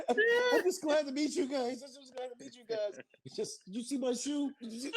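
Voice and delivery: high-pitched gushing fan voice; mock dazed fan, squeaked up high; imitating a starstruck fan.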